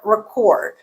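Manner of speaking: "record" as re-CORD with a strong vowel; second-syllable stress. Shown as a demonstration. Teacher showing the stressed vowel, second-syllable stress. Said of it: "we have the stressed vowel in there".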